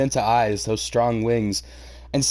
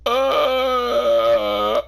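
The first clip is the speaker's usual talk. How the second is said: cringe; 11 semitones higher, 4 dB louder than usual. Real, not acted. Cringes at the crush line.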